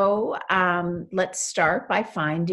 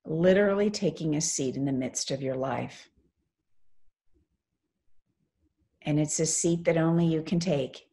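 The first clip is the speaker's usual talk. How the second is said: guided meditation. Real, not acted. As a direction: lead them gently into stillness, phrase by phrase.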